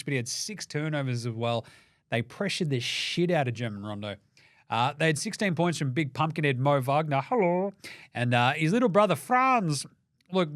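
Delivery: usual speaking voice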